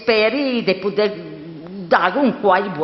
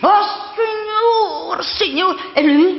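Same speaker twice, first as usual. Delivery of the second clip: higher, shocked exclamation; shocked cry; 9 semitones higher than usual. Acted. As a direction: cry out in dismay. Perpetua cries out shocked.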